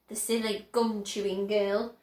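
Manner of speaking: plain reading voice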